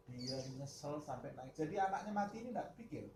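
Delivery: ordinary talk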